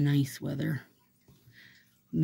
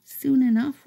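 high bird voice with soon enough rising; a bird promising grapevines, high and rising; 9 semitones higher, 8 dB louder than usual. Pretend. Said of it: "They're like".

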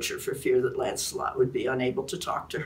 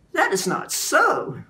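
much higher, slow fervent protest; exclaiming; 12 semitones higher, 5 dB louder, slower than usual. A narrator's reading. Lancelot protesting to Guinevere, high slow exclamation. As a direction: burst out in protest. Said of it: "Lancelot exclaimed".

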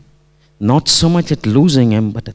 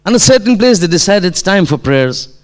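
raised, high preaching voice; impassioned sermon storytelling; 6 semitones higher, 4 dB louder than usual. Real, not acted. Preacher telling of Imam's trek with companions, impassioned. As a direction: launch the tale with force.

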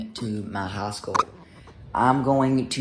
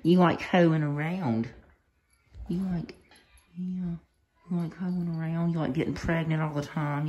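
teasing pet talk; 5 semitones higher than usual. Real, not acted.

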